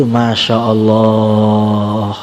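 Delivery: low, very slow, half-sung marvel; drawn-out awe. Retold or imitated. Preacher marvelling at the woman in the dream, drawn-out awe. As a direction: stretch it out in lingering wonder.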